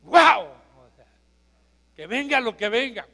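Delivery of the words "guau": loud, delighted burst of wow; exclaimed wow. Shown as a demonstration. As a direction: shout the wow in awe. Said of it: "Yo diría".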